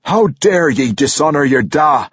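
a furious king shouting in his face. A narrator's reading. Dwarf king shouting at Brunner for dishonoring his father. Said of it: "Emerus shouted in his face".

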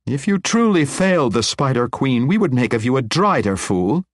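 scornful rebuke. A narrator's reading. Matron scolding the despairing wizard, scornful. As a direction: slap down his despair.

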